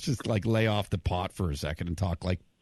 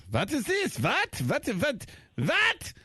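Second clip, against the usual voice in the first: very high mock German grandma; excited, high-pitched German accent impression; grandma's German accent; much slower than usual. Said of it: "I was excited trying to do my grandma".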